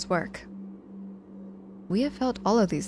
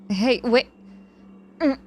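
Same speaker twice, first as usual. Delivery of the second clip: sudden loud startled cry, higher; startled hey; 6 semitones higher, 8 dB louder than usual. Acted. Patient squeezed in a hug, startled loud yelp.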